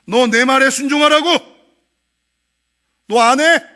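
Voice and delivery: loud, commanding voice of God; an angry God demanding obedience; stern divine rebuke. Retold or imitated. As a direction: thunder down an order from heaven.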